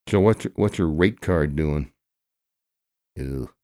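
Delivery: ordinary delivery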